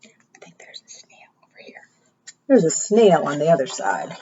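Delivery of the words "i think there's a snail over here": whisper. Shown as a demonstration. Whispering secret to children.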